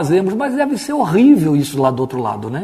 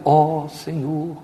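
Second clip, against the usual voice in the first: very slow, softer, solemn invocation; pompous prayer voice; 5 dB quieter, much slower than usual. Retold or imitated. Preacher contrasting prayer with normal talk, solemn affected drawl. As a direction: stretch each word in mock reverence. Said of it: "na hora de falar com Deus".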